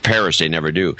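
ordinary delivery

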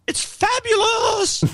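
very high, drawn-out campy squeal; slow camp exclamation way up high. Shown as a demonstration.

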